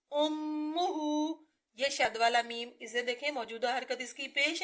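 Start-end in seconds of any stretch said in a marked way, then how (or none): 0.1-1.1 उम्मुहु with a held mm; doubled meem. Shown as a demonstration. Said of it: "हमजा पेश मीम उम".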